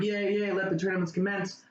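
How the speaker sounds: a light, hammed-up cheer; mock cheering. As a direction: cheer it like the whole crowd celebrating. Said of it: "Everyone's celebrating, like".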